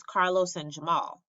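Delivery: ordinary delivery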